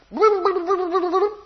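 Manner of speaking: high, loud playful vroom-vroom for a grandchild; toy car noises. Retold or imitated. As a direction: rev like a toy car on the floor.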